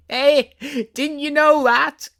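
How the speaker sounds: high, loud and slow taunt; a second daisy shouting over the first; daisy crying out. A narrator's reading. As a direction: call out smugly at the girl. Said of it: "cried another daisy".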